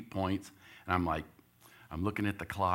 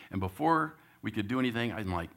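ordinary talk, nothing marked

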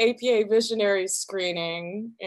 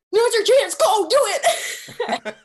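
high, loud shove of encouragement; frantic urging; 11 semitones higher, 7 dB louder, much slower than usual. Acted. Friend pushing him toward the star, high excited urging shout. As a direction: shout them into action, drawing out the end.

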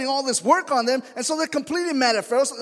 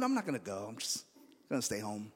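much lower and softer, a deflated mumble; reluctant giving up; 12 semitones lower, 12 dB quieter than usual. Retold or imitated. A man telling his wife he'll stay home, low and defeated. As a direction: sink the voice down as if talking yourself out of going. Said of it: "and I told my wife".

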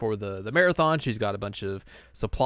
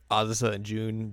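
ordinary delivery

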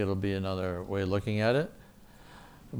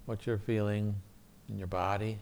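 soft, calm guided meditation voice; soft spa whisper; 5 dB quieter than usual. Acted. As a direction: gently guide them through a quiet prayer. Leads guided prayer meditation.

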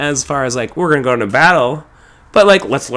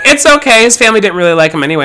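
mock dismissive; 5 semitones higher, 4 dB louder, faster than usual. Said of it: "And everyone was like".

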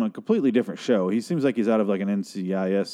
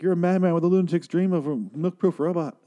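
high, theatrical declaiming; imitated over-the-top cartoon line; melodramatic cartoon quote; 8 semitones higher than usual. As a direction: declaim it like a hammy spoof scientist.

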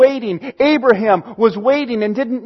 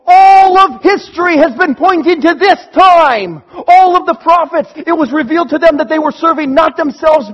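much higher and louder, overflowing with news; jubilant shout; 3 semitones higher, 3 dB louder than usual. Acted. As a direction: shout it out, history has arrived. Disciples proclaiming the resurrection.

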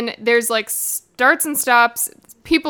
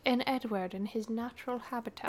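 hushed, softer wildlife narrator voice; mock nature documentary; 2 semitones lower, 12 dB quieter than usual. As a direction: present Edward like a rare animal.

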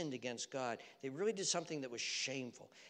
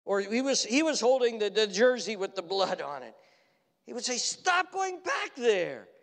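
loud, high proclaiming; full-force preaching; 9 semitones higher, 13 dB louder, slower than usual. Acted. Preacher proclaiming to the congregation, loud and rising. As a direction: build it up like a pulpit cry.

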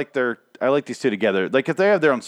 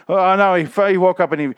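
excited alarm; 8 semitones higher, 6 dB louder than usual. Retold or imitated. Excited reaction to scene.

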